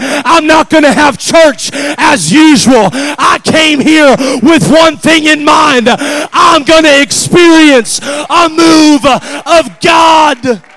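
loud, rising pulpit exhortation; full-force preaching. Real, not acted. Preacher urging the church to break chains, full-force preaching. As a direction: preach it hard to the congregation.

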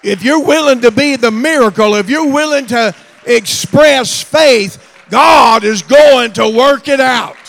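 loud, high, ringing preacher's call; full-force preaching. Acted. Preacher rousing the congregation, full-force loud preaching. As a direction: preach it out to the whole room.

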